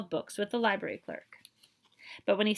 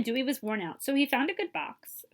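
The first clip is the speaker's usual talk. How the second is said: ordinary delivery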